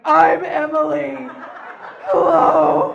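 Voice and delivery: nervous honk like a howler monkey; Emily blurting her name to the handsome guide in a strained, loud honk; howler-monkey anxiety voice. Retold or imitated. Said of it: "dry mouth anxiety voice".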